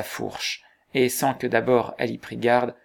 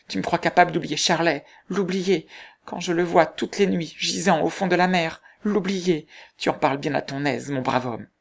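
higher, anguished protest; young woman defending her lost love, higher and anguished; desperate outcry; 6 semitones higher than usual. A narrator's reading. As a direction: plead in despair against his doubt. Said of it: "tant de désespoir dans son accent".

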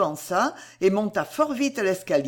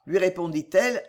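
plain reading voice

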